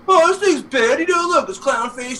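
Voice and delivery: high-pitched cartoon defensiveness; Bowser. Retelling Bowser defending his contraption to his wife, high voice. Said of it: "And he's like".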